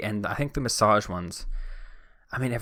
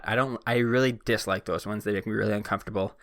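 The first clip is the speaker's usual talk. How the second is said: ordinary delivery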